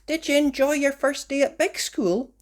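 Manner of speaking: put-on mother asking her children after school; mummy asking. A narrator's reading. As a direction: ask it like a cheerful mum. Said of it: "asked Mummy".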